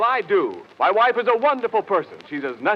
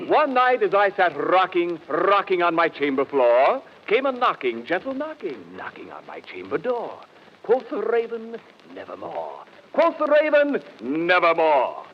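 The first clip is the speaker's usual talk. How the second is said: theatrical poem recital; slower than usual. Acted. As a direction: intone the verse like a ham tragedian.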